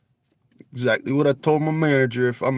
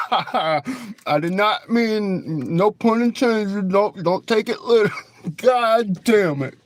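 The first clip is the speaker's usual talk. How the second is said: higher, laughing through the words; 6 semitones higher, slower than usual. Real, not acted. Streamer laughs at his own accidental pun to chat. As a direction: crack up at your own pun.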